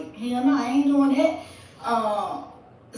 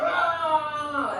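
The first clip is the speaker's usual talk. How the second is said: sudden high cry of pain, imitated; pained yell; 7 semitones higher than usual. Boyfriend bitten on the neck, imitated sudden pained yell. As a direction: yelp like someone just bit your neck.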